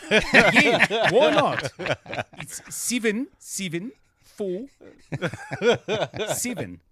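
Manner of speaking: mock New Zealand accent. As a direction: read digits in a joking New Zealand accent. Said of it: "in New Zealand".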